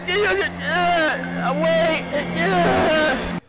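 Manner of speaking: high, loud frightened shouts; panicked shouting. Real, not acted. Gamer spotting the ghost girl again, high panicked shouts. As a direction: yell in real panic.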